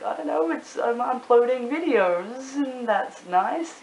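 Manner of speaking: high, slow, drawn-out awkward answer; awkward mock reply. Acts out awkward answer to question.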